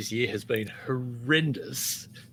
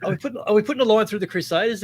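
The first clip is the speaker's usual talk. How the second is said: rattled-off question, higher pitch; quick quoted question; 7 semitones higher, 3 dB louder, much faster than usual. Retold or imitated. Co-host mimicking the pundits' question about the Crusaders, quick and higher. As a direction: ask it fast, voice lifted. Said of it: "you're going".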